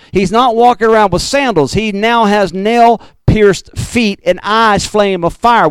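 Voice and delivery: normal talking voice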